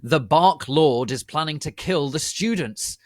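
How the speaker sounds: high, loud yell. Acted. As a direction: yell the warning. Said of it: "yelled Cornelia Fudge".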